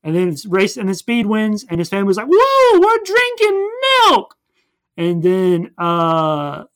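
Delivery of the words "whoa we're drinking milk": much higher, louder, giddy whoop; cartoon cheer. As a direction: squeal it like an overjoyed cartoon family. Family celebrates win in recap.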